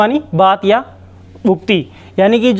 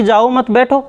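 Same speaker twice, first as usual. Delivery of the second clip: "जाओ मत बैठो" run together, rising at the end; no break anywhere in "जाओ मत बैठो"; no comma pause; 3 semitones higher than usual. Shown as a demonstration. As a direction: say "जाओ मत बैठो" in one unbroken breath. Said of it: "coma तो बोलेगा नहीं".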